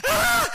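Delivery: very high, loud scream of fright; imitated child's shriek. Daughter as another one comes out the other side, imitated shrieking. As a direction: squeal like a terrified little girl. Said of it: "She's like".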